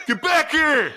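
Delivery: high-pitched strained yell, imitating himself; retold scream. Screaming at kids running away with the candy, mock scream. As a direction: yell it high, as if calling runaways back. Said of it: "I just screamed at them".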